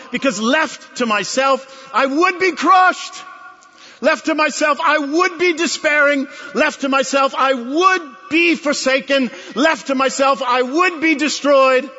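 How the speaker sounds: preacher's passion mounting; rising preaching intensity. Real, not acted. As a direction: build the cry of helplessness. Preacher builds to climax.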